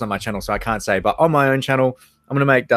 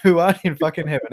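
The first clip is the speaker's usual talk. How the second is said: higher-pitched attempt at an Irish accent; bad Irish accent; 5 semitones higher than usual. Shown as a demonstration. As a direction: play a swearing Irish priest, badly. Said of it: "I'm really bad at this".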